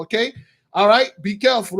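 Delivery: ordinary delivery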